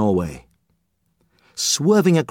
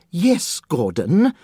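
ordinary delivery

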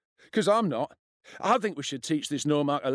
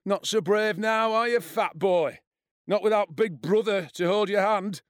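taunting; 9 semitones higher, 4 dB louder than usual. A narrator's reading. Bully jeers at boy without his big brother, taunting. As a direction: gloat over him, drawing it out.

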